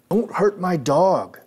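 high, loud and slow plea; boy crying out to save his dog; desperate yell. Retold or imitated. Boy shouting at Victor to spare his dog, desperate yell.

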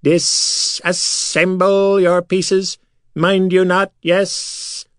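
high, very slow alien voice; high slow creature voice. A narrator's reading. Silver Sand asking the prisoners calmly. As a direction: draw it out high and strange.

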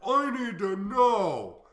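high, loud, slow mocking whine; mocking whiny fan. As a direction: drag it out like a needy fan. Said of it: "But people are like".

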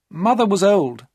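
put-on young woman justifying herself; Maria's voice.

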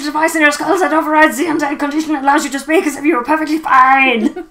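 loud, high mad-doctor proclamation; boastful mad scientist. Acted. Doctor explains the skull device to his patient, loud mad-scientist boasting. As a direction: build to a loud, triumphant finish.

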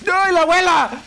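high-pitched cry of alarm; startled panic. Real, not acted. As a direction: yelp as she suddenly appears. Grandma appears suddenly in game. (grito de pánico)

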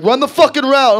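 loud, high angry order; yelling dad. Acted. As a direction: yell it like a fed-up sports dad. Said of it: "Just getting yelled at".

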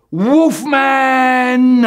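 loud high shout of "Wolfman!". Acted. Calling out the monster's name with a loud shout. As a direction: bellow the name out.

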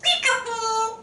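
high game-of-peekaboo call; playful peekaboo. Real, not acted. Playing peekaboo.

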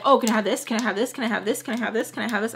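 mock rapid-fire asking, over and over; pestering repetition. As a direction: rattle off the request like a nagging kid. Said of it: "I wasn't saying that".

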